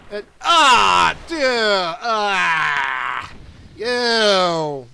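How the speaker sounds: loud grossed-out yell; disgusted shout. Real, not acted. Boss vomits up treasure.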